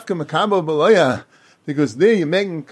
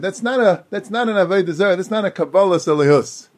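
ordinary talk, nothing marked

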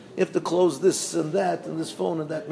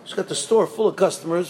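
normal talking voice

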